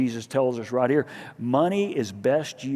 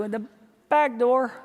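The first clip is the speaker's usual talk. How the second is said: higher sheepish reply; mock sheepish; 7 semitones higher than usual. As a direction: answer like a kid caught out. Sheepish answer to agent.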